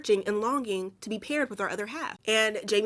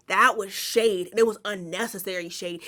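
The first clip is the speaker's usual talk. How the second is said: ordinary talk, nothing marked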